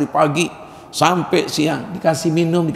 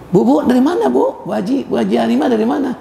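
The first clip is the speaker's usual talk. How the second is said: put-on high, eager sweet-talk to an old lady; 8 semitones higher than usual. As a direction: call out sweetly like a smitten suitor. Man sweet-talking old widow. (menggoda manis dengan suara tinggi)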